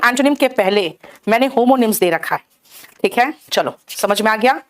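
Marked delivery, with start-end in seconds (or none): none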